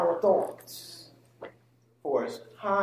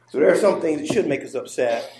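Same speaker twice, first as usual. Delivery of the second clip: normal talking voice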